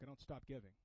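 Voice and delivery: soft low voice of a sick elder who still gives; quiet resolve. Retold or imitated. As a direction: say it simply and firmly. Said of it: "He's like".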